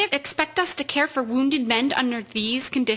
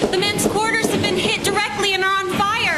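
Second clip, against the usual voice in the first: urgent alarm; 6 semitones higher than usual. Acted. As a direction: raise the alarm during the bombing. Air raid alarm in hospital.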